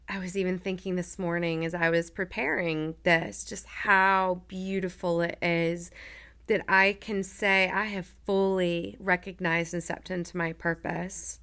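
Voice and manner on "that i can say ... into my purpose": usual speaking voice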